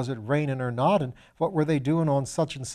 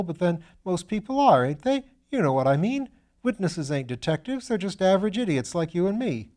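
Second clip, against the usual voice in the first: put-on higher, bright-eyed young medical student voice; eager young student; 6 semitones higher, 4 dB louder than usual. Young Piggott protesting to Lord Peter, higher eager student voice. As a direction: play the eager, slightly foolish young student.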